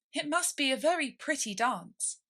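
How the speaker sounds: ordinary delivery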